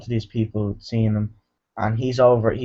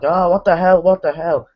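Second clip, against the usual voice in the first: higher, louder imitation of panic; mock freaking out; 7 semitones higher, 5 dB louder than usual. Vlogger acting out victims under chainsaw attack, mock panic. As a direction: raise pitch and volume in pretend alarm. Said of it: "they're freaking out".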